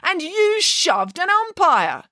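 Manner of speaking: high, slow accusing headmaster. A narrator's reading. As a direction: point the finger slowly. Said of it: "declared the headmaster".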